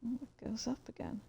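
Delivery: fast, unvoiced whisper to himself; whispered aside. Real, not acted. Streamer spots something moving on screen, quick whisper. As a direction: mutter it off-mic, noticing something.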